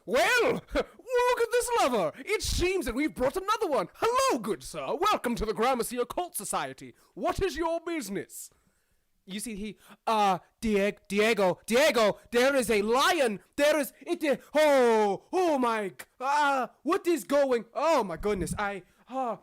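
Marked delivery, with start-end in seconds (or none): 0.1-8.5 high, theatrical welcome voice; grand greeter. Acted. Figure welcomes newcomer to the occult society, grand and theatrical. 10.6-19.4 higher, stammering alarm; acted shock, stumbling over broken phrases; flustered panic. Brother tells Diego there is a lion, flustered panic.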